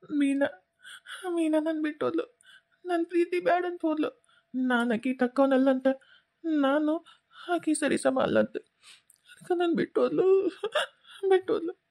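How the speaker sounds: high, halting, heartbroken sobbing; tearful wail of someone jilted; crying. Acted. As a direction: choke out the words through tears. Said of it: "ನೀನ್ ಅಳಕತ್ತಿರೋದು".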